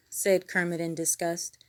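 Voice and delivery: ordinary delivery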